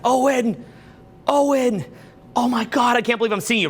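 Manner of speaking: starstruck fan spotting him on the street; excited fan. Retold or imitated. Fan spotting Owen on the street, calls out thrilled. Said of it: "somebody who knows me from online is like".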